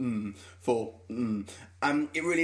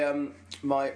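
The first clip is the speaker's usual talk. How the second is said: usual speaking voice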